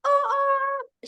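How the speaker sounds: high, wailing oh my grandmama; hammed-up tearful wail; mock crying. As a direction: retell the sobbing in a high wail. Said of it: "And I'm in crying like".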